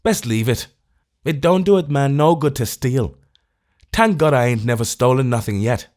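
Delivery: resigned Irish mutter of virtue; gloomy. A narrator's reading. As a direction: tramp turns from the milk, gloomy Irish voice. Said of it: "saying gloomily".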